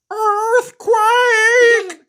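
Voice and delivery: very high, loud screech; macaw screech. Acted. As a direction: screech it in alarm like a parrot. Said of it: "a macaw screeched".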